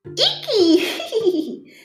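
put-on high little-girl squeal with a giggle; very high, laughing child voice. A narrator's reading. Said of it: "she laughed".

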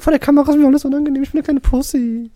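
fast, high mock-whiny voice; nachgeäfftes Jammern. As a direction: mimic a friend's excuses in a high voice. Mocking the reluctant friend's excuses. (schnell und hoch nachgeäfft)

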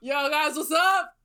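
playful imitation of an anime dude; Lev's dub voice. Riffing on the Russian character's dub, imitated dude voice. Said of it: "his dub voice is like".

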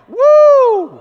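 high, loud woo to lead the crowd; preacher models the whoop for the congregation; crowd-prompt whoop. Shown as a demonstration. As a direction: whoop to get them going. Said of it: "Everybody go".

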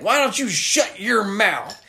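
mock menacing killer line; Otis quote. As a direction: snarl it like the killer. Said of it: "he's like".

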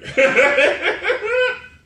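big burst of laughter, high and loud; cracking up loudly at his own joke. Real, not acted. Laughing hard at his football joke.

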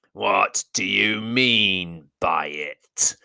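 slow, menacing goblin king; great goblin voice. Acted. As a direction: drawl it out like a goblin chief.

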